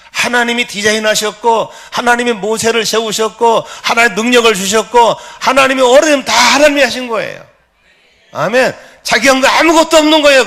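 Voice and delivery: high, loud sermon delivery; impassioned preaching. Real, not acted. Preacher to congregation on God doing everything, impassioned.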